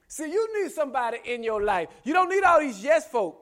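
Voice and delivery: loud preacher's admonition, rising high; full-force preaching. Acted. Preacher warns congregation about yes folk, full-force preaching. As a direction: belt the warning out to the congregation.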